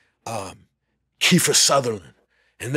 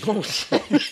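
laughing realization; 7 semitones higher than usual. Real, not acted.

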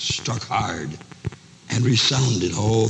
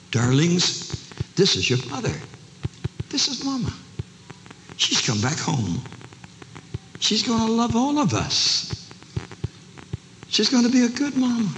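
earnest reassurance; 13 semitones higher than usual. Acted. Father presents mother to children.

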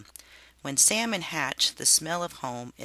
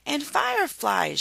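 gleeful cry; 12 semitones higher, 4 dB louder than usual. A narrator's reading. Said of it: "she gleefully cried".

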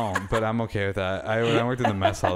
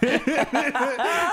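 high burst of laughter; 3 dB louder than usual. Real, not acted. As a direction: crack up at the story. Laughing at the meme.

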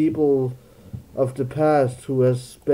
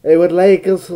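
raised, louder stab at British; mock British, pushed louder; attempted British accent; 5 semitones higher, 10 dB louder than usual. Shown as a demonstration. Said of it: "How does it sound in British?".

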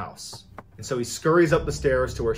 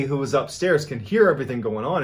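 usual speaking voice

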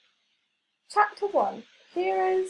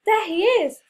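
loud, high triumphant call; cried out; 7 semitones higher, 7 dB louder than usual. A narrator's reading. Flex spotting the clown through the window, loud cry. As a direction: point and shout it out. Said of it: "Flex cried".

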